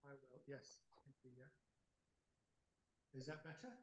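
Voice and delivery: faint remote line; far-off, barely heard over the call; barely audible. Real, not acted. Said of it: "a bit better".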